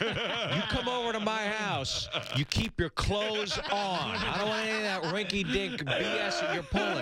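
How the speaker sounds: a hammed-up stern host, laughing; mock stern Gardell. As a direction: lay down the law in a silly voice. Gardell voiced laying down rules.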